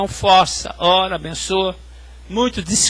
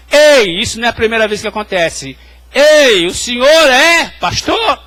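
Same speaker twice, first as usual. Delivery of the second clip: calling out; 3 semitones higher, 7 dB louder than usual. Retold or imitated. Man calls him in the corridor.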